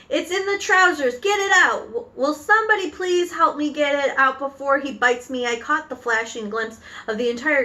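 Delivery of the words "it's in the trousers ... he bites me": high, frantic plea for help; shouting. Acted. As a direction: holler in panic as the cook. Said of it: "the stupid cook still shouting".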